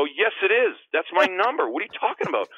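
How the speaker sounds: hammed-up indignation at a kid; indignant protest. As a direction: argue back in mock outrage. Arguing with kid about his number.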